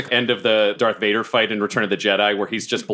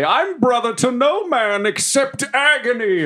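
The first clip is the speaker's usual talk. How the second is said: theatrical cry of agony, pitched way up; battle cry. Acted. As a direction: howl it out over the arena.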